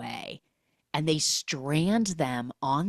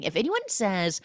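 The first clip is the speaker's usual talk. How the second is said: ordinary talk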